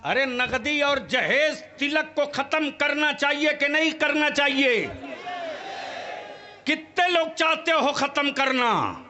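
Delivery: loud, high, fervent sermon delivery; fiery preaching. Acted. Preacher challenging the gathering on dowry, loud fervent preaching. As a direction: preach it hard and rouse the crowd. Preacher polls the crowd loudly.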